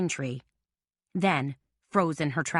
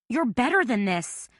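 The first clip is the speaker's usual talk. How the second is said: pleading; 6 semitones higher than usual. A narrator's reading. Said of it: "Carmela pleaded".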